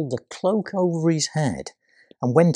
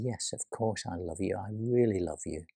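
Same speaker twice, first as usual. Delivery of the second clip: quiet; 4 semitones lower, 7 dB quieter than usual. Acted. Peter answering Jesus inwardly.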